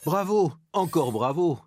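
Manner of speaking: concert-hall cheer; enthusiastic cheer. Shown as a demonstration. Demonstrates the exclamation.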